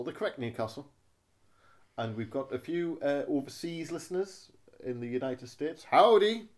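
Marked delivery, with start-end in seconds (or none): 5.8-6.5 mock cowboy howdy. Greeting US listeners.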